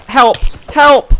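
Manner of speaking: high, loud cries for help; panicked shout. Real, not acted. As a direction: scream it as the creeper closes in. Creepers closing in.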